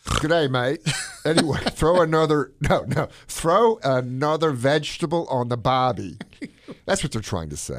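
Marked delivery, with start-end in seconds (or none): 0.0-0.8 hammed-up Australian, higher and louder; Aussie greeting, overdone for laughs; mock Australian. Host mocks an Australian accent for his co-host. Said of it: "will probably get sued by the Aussies". 1.2-6.2 loud, high hammed-up Australian accent; mock Australian. Host mocks Australians about vegetables, in a loud Australian accent. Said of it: "will probably get sued by the Aussies".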